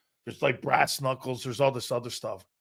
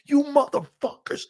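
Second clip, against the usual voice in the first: imitated furious coach, high-pitched tirade; coach hollering; 9 semitones higher than usual. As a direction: chew out the players in a high, strained voice. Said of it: "I'd never been hollered like that".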